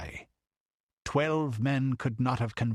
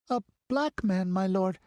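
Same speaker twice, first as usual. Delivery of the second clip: put-on much higher frightened little girl; stammered; 9 semitones higher, 3 dB louder than usual. A narrator's reading. The child shrinking from the count, stammering girl's voice. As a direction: falter it out timidly. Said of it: "she stammered".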